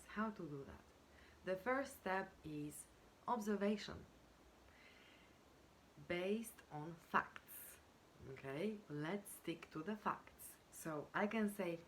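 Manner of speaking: ordinary delivery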